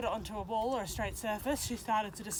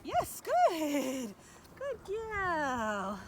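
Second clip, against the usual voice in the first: praise; 5 semitones higher, much slower than usual. Real, not acted. Praising dog after trick.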